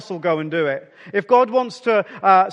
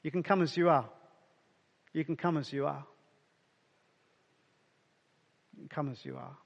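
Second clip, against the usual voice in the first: each repeat softer until almost a whisper; fading to hush; 5 semitones lower, 11 dB quieter, faster than usual. Shown as a demonstration. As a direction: let it fade away, gentler each time. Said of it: "Breathe that in for a moment".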